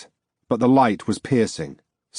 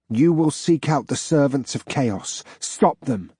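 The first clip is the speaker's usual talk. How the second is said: a hint of an otherworldly command; lightly voiced spectral order; commanding ghost; 4 semitones higher than usual. Glowing ghost ordering the narrator, otherworldly.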